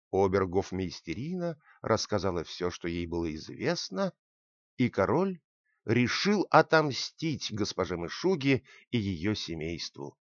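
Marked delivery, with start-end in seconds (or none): none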